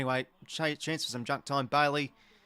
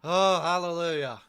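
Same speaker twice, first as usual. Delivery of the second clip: relieved cheer; 3 semitones higher, 5 dB louder than usual. Real, not acted. Fan celebrating the final siren, relieved loud cheer. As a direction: exhale it all out, thank heaven.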